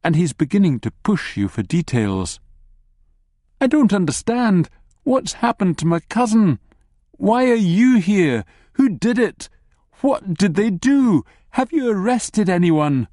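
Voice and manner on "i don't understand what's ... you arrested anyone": high, anxious pleading questions; upset. Acted. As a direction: press for answers, rattled and high. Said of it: "but obviously very upset".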